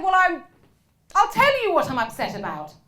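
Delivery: mock-aggrieved outburst; indignant retort. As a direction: build to a slow, outraged question. Upset audience member's retort, acted out.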